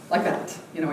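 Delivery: normal talking voice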